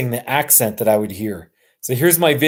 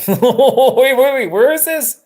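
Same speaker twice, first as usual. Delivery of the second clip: high, laughing "hold on" reaction; amused, high-pitched bewilderment; laughing surprise; 12 semitones higher, 4 dB louder, slower than usual. Real, not acted. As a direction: break into a laugh, voice jumping up.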